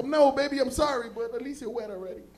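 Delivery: high and loud, begging then cheeky; a sweaty guy sweet-talking his girl; pleading boyfriend. Retold or imitated. Apologizing to girlfriend.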